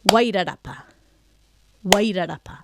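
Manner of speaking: Wairarapa stressed on Wai, falling away after it; stress on first syllable. Shown as a demonstration. Teacher saying place names aloud, first-syllable stress on Wairarapa. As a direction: lean on the Wai of Wairarapa and let the rest drop softly. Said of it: "so you can hear with a clap where that".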